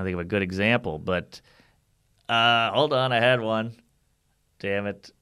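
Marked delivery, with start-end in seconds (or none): 4.6-5.1 hungover and foggy, can't focus. Real, not acted. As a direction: sluggish hungover mumble. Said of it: "I'm so hungover".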